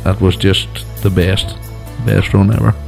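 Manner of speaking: normal talking voice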